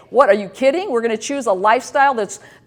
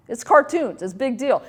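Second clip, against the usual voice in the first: normal talking voice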